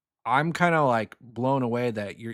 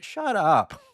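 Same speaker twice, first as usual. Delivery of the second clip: cranky retort; 6 semitones higher, 3 dB louder than usual. Real, not acted. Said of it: "first time I've ever said that".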